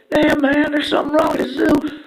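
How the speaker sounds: shocked zoo visitors, squeaky and loud; people at the zoo. Retold or imitated. Zoo visitors complaining about the smell, high and loud. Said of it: "people are like".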